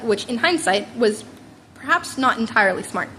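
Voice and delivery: usual speaking voice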